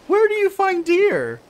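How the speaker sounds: high, loud giggly recap; laughing at her own surprise; squeaky laughing quote; laughing squeal. Real, not acted.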